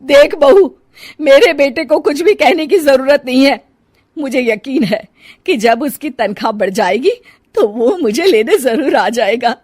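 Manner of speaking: put-on loud, high stern old mother voice; stern mother-in-law. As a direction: scold the daughter-in-law sharply.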